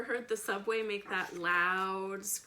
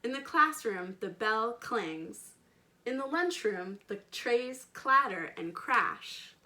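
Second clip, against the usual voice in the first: slow, louder storybook reading with big emphasis; reading to children; 3 semitones higher, 7 dB louder than usual. A narrator's reading. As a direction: paint each noise for the little listeners. Expressive read-aloud narration.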